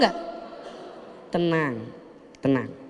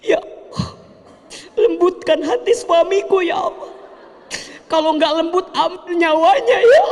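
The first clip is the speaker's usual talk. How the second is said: hammed-up panicked supplication; mock desperate prayer; 11 semitones higher than usual. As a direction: pray like a frantic wife at the end of her rope. Frantic wife praying to God.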